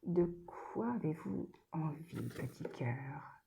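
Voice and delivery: soft unvoiced whisper to the card. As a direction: lean in and whisper secretly. (chuchoté doucement)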